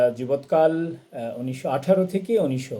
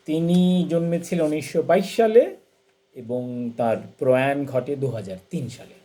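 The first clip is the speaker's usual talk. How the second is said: normal talking voice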